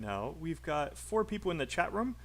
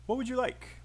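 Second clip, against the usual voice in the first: brisk, high-pitched model sentence; really fast; 9 semitones higher than usual. Shown as a demonstration. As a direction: run "would you" together quickly, pitched high. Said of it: "practice saying this really fast".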